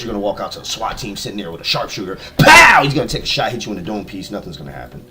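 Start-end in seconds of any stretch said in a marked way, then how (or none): 2.4-2.8 loud, high explosive "pow"; imitated gunshot. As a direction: fire off one huge shot with the voice. Imitates the sharpshooter's shot.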